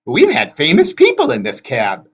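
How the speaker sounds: high, loud Gray Sister exclaiming; exclaiming hag. A narrator's reading. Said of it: "Anger exclaimed".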